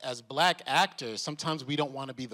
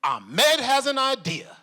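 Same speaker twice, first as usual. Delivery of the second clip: higher, louder, slow and laughing; excited announcement; 10 semitones higher, 7 dB louder than usual. Retold or imitated. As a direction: proudly present your friend's idea. Liam presenting idea to George.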